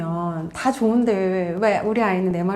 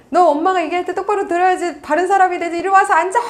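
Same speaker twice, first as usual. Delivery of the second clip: high, louder acted scolding; acted cross mother giving orders; commanding parent; 8 semitones higher, 4 dB louder than usual. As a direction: order the child about, voice rising. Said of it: "막 이렇게 명령하시고".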